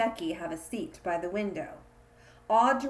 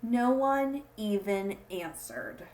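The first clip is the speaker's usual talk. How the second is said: usual speaking voice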